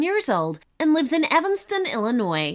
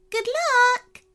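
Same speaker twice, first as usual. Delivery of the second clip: much higher, bright send-off; a tiny fairy waving goodbye; 13 semitones higher than usual. Acted. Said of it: "said the first fairy with a wave".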